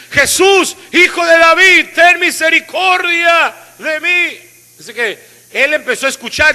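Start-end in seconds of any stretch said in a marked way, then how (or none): 0.1-4.4 high, loud, slow desperate cry. Acted. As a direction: call out to Jesus for mercy. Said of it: "comenzó a dar voces".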